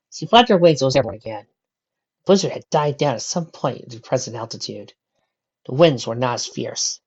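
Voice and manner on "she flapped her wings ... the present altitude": ordinary delivery, nothing marked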